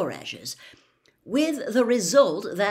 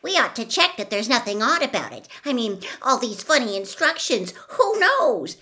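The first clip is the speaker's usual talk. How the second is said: eager; 4 semitones higher, 6 dB louder than usual. A narrator's reading. Hermione eager to check book.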